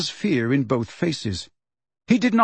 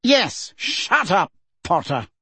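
sharp, higher-pitched bark; barked order; 5 semitones higher, slower than usual. A narrator's reading. Fudge silencing Potter, barking. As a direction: bark it at him, curt. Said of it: "barked Fudge".

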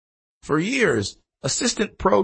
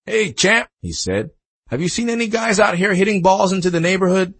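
raised, higher hail; hailing someone from a golf cart; calling out; 7 semitones higher, 4 dB louder than usual. A narrator's reading. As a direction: call out a friendly question across the range. Pro calls out to Tiger.

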